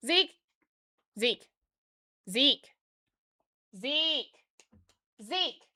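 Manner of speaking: scolding call to a pet. Real, not acted. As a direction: yell the dog's name across the house. Calling out to the dog.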